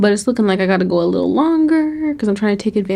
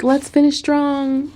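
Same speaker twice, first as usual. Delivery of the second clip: mock encouragement; 6 semitones higher, slower than usual. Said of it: "So I'll be like".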